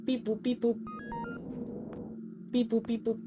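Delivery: high sing-song "Pipu, pipu" coaxing call; cute calling voice. Pretend. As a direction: coax it over like a pet. Calling a creature in game.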